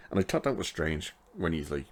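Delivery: ordinary delivery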